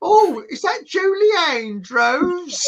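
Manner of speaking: high, loud, slow hammed-up old lady; loud lady in cinema. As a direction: ham up a loud posh cinema-goer asking. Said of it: "she starts speaking loudly".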